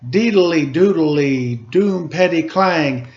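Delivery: put-on lively read-aloud to children; storybook reading voice; story narration. A narrator's reading. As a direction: read it like a picture book, rhythmic. Reads picture book aloud.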